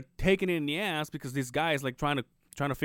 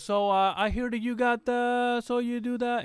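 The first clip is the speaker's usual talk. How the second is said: higher, slow imitation of the guy; how he talks; 10 semitones higher, slower than usual. Retelling the nosy guy's questions, in a higher, slower imitation of him. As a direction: mimic his way of talking, pitched up and slowed. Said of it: "and this is how he talks".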